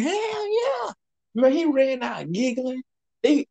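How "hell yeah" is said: very high-pitched eager reply; mimicked excited newcomer. New guy confirms he heard it too, high excited mimicry.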